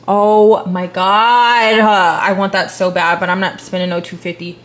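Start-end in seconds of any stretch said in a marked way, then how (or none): 0.1-1.8 drawn-out awe. Real, not acted. Awe at hat.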